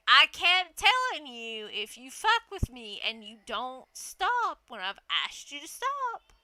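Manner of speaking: loud, slow I-warned-you tone; defiant. Retold or imitated. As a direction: stand your ground. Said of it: "I was like".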